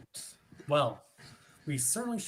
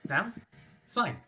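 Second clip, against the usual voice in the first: ordinary talk, nothing marked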